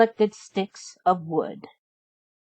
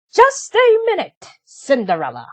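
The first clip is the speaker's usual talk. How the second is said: much higher, louder shrill-bossy call; bossy stepsister; 9 semitones higher, 7 dB louder than usual. A narrator's reading. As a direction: stop her in her tracks, loud and high. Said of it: "said Drizella".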